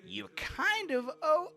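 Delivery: high, drawn-out, insinuating gnome voice; wheedling gnome. Acted. Gnome hints that rescued giant owes them, wheedling. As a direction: lean on the debt, slowly and loudly.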